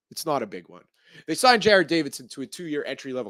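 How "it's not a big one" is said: deadpan correction. Acted. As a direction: lower and softer, flat letdown. Deadpan retraction of own hype.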